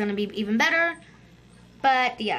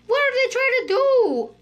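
laughing protest; 11 semitones higher, 5 dB louder than usual. Real, not acted. Trapped by other players.

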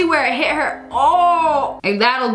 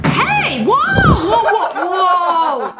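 startled laughing whoa; much slower than usual. Real, not acted.

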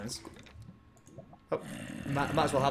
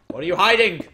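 ordinary talk

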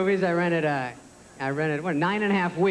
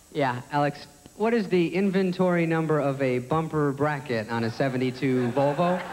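low, even know-it-all voice; brainy contestant, pitched down; game show champ; 6 semitones lower than usual. Acted. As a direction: answer in a deadpan low contestant voice. Plays champion contestant answering.

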